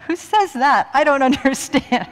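loud, high, laughing incredulity; amused disbelief. Real, not acted. Speaker reacts to an absurd example for the audience, amused disbelief. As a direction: exclaim in baffled amusement.

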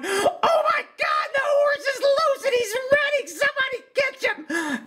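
mock a terrified spectator screaming; imitating a shrieking, panicking crowd member; panicked audience. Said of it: "people in the audience going".

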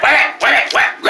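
high, angry shouted wham it. Shown as a demonstration. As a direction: bark it out furiously. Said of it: "Say that".

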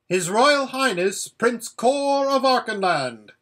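high, loud ceremonial proclamation; formal herald. Acted. Voice outside announces the prince, formal herald. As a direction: proclaim the royal title like a herald.

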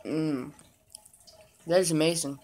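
talking between bites; savoring a snack; mouth full while chewing. Real, not acted. Seen 0: chewing at start, then puts another piece in mouth ~2.3s.

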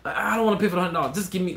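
mock cheap caller. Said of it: "some people just be like".

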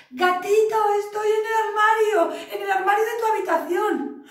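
high, loud call from inside the wardrobe; mother calling out. Acted. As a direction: shout to the kitten where she is hiding.

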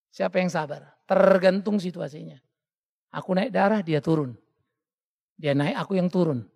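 ordinary talk

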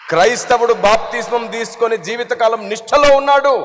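loud, high declaring sermon; forceful preaching. Acted. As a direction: proclaim it with conviction.

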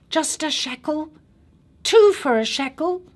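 put-on high pleading child voice; a small boy selling chocolate; child street seller. A narrator's reading. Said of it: "the child's voice fills the car".

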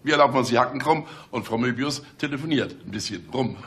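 ordinary talk

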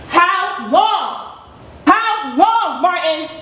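rising anger. Acted. Wife repeats question, rising anger.

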